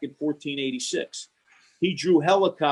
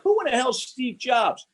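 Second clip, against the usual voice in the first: mock surprise; 10 semitones higher than usual. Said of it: "I said".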